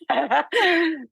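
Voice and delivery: short high laugh through held-back tears; nervous, choked little laugh; embarrassed laugh. Real, not acted. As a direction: laugh it off before the hard part. Nervous laugh before sad story.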